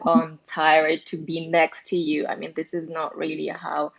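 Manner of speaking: normal talking voice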